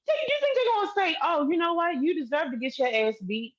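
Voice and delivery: very high-pitched, scornful question; mocking incredulity. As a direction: voice shoots up, ridiculing his logic. Imagines police siding with him.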